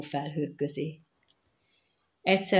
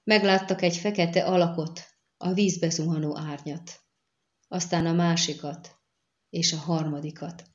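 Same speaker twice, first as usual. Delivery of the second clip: ordinary delivery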